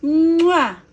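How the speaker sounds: big, loud "mwah"; blown kiss sound. Shown as a demonstration. Adult prompting the child's flying kiss, blown kiss sound. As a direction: throw a high, loud kiss noise. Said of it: "Flying kiss".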